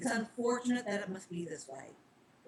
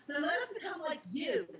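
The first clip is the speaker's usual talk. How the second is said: pleading; 7 semitones higher than usual. Acted. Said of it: "Charlie pleaded".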